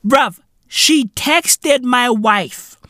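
ordinary talk

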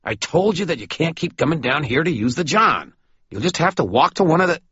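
high, annoyed rebuke, speeding up; irritated scolding. Acted. Maroon scolding Stu about using the John, irritated.

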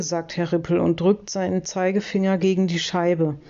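usual speaking voice

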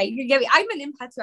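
ordinary talk

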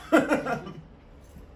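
high burst of laughter; loud laugh. Real, not acted. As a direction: let out a big laugh. Laughing at drunk confession.

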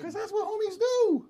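squeaky high falsetto; mock-gushing. Questioner mocks the friend's promise, high hammed-up homie cheer. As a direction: jump way up into a silly high voice.

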